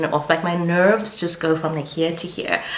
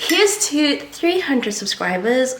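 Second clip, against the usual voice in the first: high, slow, drawn-out cheer; gleeful, lilting celebration; celebratory toast; 7 semitones higher, slower than usual. Real, not acted. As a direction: raise a toast, stretching the words out high. Toasting a subscriber milestone.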